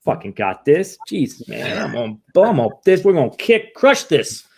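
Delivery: high, loud, cocky fake confidence; mock bravado. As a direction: pump it up like nothing can touch you. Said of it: "what we showed on the exterior facade".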